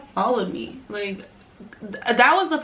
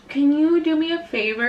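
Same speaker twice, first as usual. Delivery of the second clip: high-pitched mock polite request; imitated girlfriend; 7 semitones higher than usual. Vlogger imitating girlfriend asking a favour, high mock-sweet voice. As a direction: put on the other girl's higher voice.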